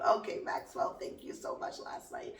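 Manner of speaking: whispered, breathy aside to Maxwell; teasing whisper. Pretend. Teasing Maxwell in a sly whisper. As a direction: hush it like a naughty secret.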